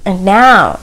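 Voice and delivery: ordinary talk, nothing marked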